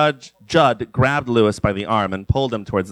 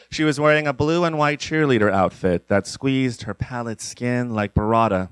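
plain reading voice

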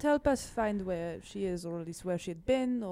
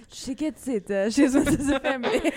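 laughing; 4 semitones higher, 9 dB louder than usual. Retold or imitated. Mimicking Brianna, laughing.

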